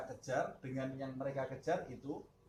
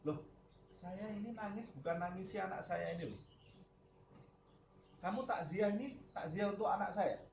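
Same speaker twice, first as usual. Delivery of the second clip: a lightly raised, puzzled protest; saint's indignant protest; 7 semitones higher than usual. Acted. The saint protesting to the mourners, lightly raised and puzzled. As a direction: set the mourners straight, a touch higher.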